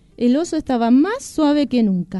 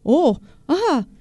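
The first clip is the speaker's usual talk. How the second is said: taken-aback oh, then a smiling hello; smiling surprise; 4 semitones higher, slower than usual. A narrator's reading. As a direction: start higher, then settle into a pleased greeting. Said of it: "le sonrió el rey".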